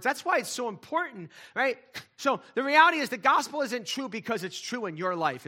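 preaching at full force. Acted. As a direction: drive the point home from the pulpit.

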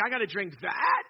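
loud, incredulous objection; mock disgust. American traveler balks at the local water, mock disgust. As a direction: balk loudly at the offered drink.

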